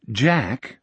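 ordinary delivery, nothing marked

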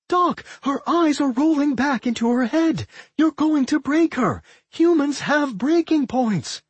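high-pitched, urgent warning; frantic alarm. A narrator's reading. Friend warns Doc he will break the girl, frantic. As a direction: plead in rising panic, pitched high.

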